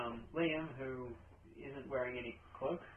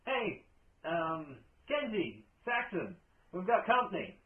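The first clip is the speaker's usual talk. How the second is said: raised, higher calling voice; 6 semitones higher, 7 dB louder, slower than usual. Acted. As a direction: call to your companions up ahead, louder. Mouse guard calls out to companions.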